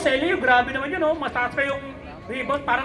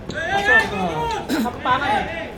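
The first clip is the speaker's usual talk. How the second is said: ordinary delivery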